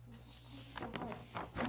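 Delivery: slow, breath-soft sympathy; empathy. Shown as a demonstration. Mom locking in with child on the floor, tenderly empathetic. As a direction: commiserate in a slow, near whisper. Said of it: "I'm just locking in".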